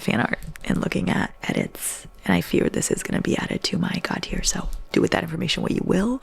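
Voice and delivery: breathy whispered aside; hushed confiding whisper. Real, not acted. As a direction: whisper it like a secret confession.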